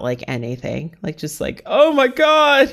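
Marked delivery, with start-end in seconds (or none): 1.6-2.7 high, loud, drawn-out gush; over-the-top excited squeal of delight; mock excitement. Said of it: "I would get excited about".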